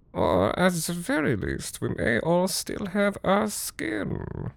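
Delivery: lightly voiced host persona sinking to a hush at the end; eccentric German host.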